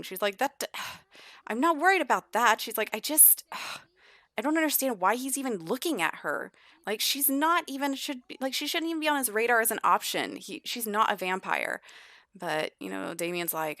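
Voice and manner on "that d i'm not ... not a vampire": frustrated rant. Acted. Arya voiced, frustrated rant.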